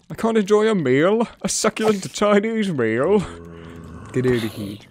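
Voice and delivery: high, indignant outraged man; meme quote. Acted. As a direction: play it as the wronged diner. Quoting the meme.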